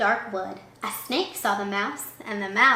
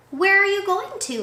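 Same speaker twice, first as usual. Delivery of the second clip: hooting owl; 9 semitones higher, 3 dB louder than usual. Acted. Owl voiced.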